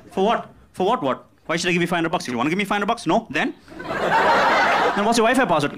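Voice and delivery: indignant guest. Retold or imitated. Guest arguing over the price.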